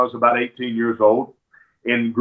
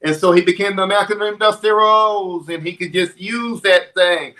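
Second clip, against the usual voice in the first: higher-pitched lisping Southern wrestler voice; Dusty Rhodes; 5 semitones higher than usual. Acted. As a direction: turn the lisp into a wrestling character. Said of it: "Dusty Rhodes always had that lisp".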